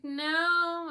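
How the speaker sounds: high, drawn-out retort; retold defiant no. Car owner retelling the comeback to the officer, defiant. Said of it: "I'm like".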